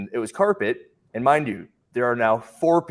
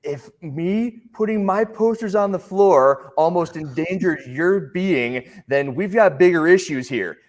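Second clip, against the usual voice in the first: higher, slow, snooty comeback; retelling his own smug reply to the shopper; sarcastic retort; 8 semitones higher, 4 dB louder, slower than usual. Retold or imitated. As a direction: deliver the put-down with mock superiority. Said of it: "and I go".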